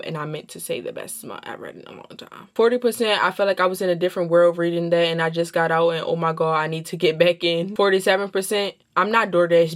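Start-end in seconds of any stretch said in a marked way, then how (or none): none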